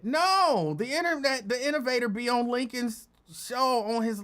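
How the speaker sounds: high, insistent "not dead", NOT punched; emphatic correction. Real, not acted. Host correcting a listener who asks if innovator is dead, emphatic correction. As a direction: set it straight, pitched high, landing hard on "No" and "not dead".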